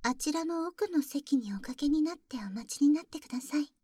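ordinary talk, nothing marked

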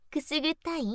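high giggle into the question; teasing laugh. Acted. Big sister tickling her brother, giggling question. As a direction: laugh while asking if it tickles.

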